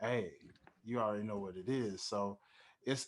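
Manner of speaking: ordinary talk, nothing marked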